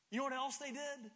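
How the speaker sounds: normal talking voice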